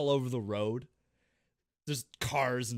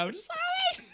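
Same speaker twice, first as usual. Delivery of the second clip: high, fast, laughing babble; laughing hysterically; slower than usual. Real, not acted. Laughing about his own screaming, high and fast. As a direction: squeal it out mid-laugh. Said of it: "what I was screaming".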